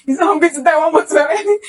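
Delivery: frantic retold cry to God; panicked plea. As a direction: plead in rising alarm.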